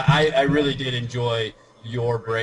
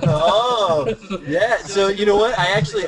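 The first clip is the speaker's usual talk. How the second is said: excited outburst; 9 semitones higher, 7 dB louder than usual. Real, not acted. Excited reaction to topic.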